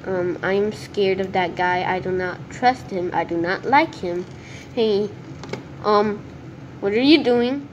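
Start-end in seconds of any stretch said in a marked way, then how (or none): none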